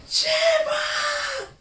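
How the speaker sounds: very high, loud drawn-out yell; imitated scream. Fan retelling her jump from plane, screamed name. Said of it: "All he hears".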